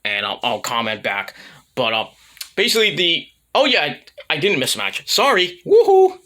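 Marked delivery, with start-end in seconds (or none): 5.6-6.2 high, loud woohoo; playful high-pitched cheer; mock cheer. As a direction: whoop it out in a much higher voice. Mock cheer after apologizing for mistake.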